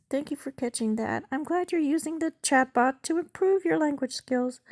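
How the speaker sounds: high-pitched bot character; chatbot voice. Acted. Chatbot thanks user for catching a typo, high bot voice.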